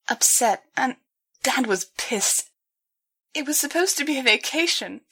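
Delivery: halting, tearful. A narrator's reading. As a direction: push through false starts. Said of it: "several false starts".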